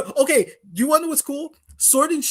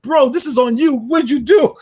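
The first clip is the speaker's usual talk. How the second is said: higher, louder mock accusation; mock Leon; 7 semitones higher, 4 dB louder than usual. Fan imagining Leon scolding the chairman, higher and louder. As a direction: blame him like a fed-up rival.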